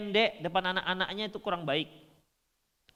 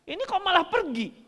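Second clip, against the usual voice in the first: puzzled complaint; 10 semitones higher, 4 dB louder than usual. Retold or imitated. Friends puzzled that Prophet leaves.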